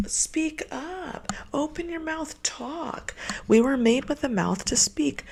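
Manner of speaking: exasperated high hushed lecture; exasperated demand. Real, not acted. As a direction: scold him in a tight high hiss. Demanding ex communicate.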